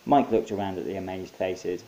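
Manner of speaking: usual speaking voice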